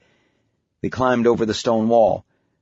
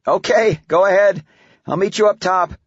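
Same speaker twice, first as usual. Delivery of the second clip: higher, raised voice to someone ahead; calling out; 9 semitones higher, 4 dB louder than usual. Acted. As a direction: call up the path to your daughter. Dad calls up to daughter.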